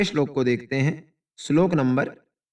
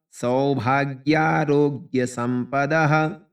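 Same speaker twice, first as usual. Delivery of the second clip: slow word reading; 3 dB louder, much slower than usual. Shown as a demonstration. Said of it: "कठिन शब्द इसमें देखें".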